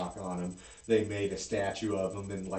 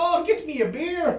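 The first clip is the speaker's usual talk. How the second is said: put-on failed Irish accent, much higher and louder; botched Irish brogue ordering a beer, pitched way up; bad Irish accent; 10 dB louder than usual. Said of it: "I can't do a good Irish".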